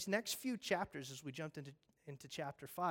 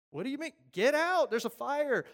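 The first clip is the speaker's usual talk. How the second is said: much higher and louder yell; exasperated shout; 9 semitones higher, 10 dB louder than usual. Acted. Firefighter yelling at people who won't leave, exasperated. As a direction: shout in disbelief. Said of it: "firefighter exasperated".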